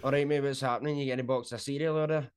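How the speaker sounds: broad, casual Scottish accent; quick, unenunciated Scottish patter; everyday Scottish speech. Shown as a demonstration. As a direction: run it together like a local Scot in the shop. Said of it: "go into the grocery store in Scotland kind of speaking voice".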